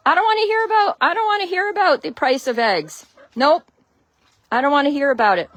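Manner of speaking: high, loud mock refusal; loud, teasing I-won't-listen tone; playful protest. Real, not acted. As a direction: wave off the topic in a high voice. Viewer asks about egg prices.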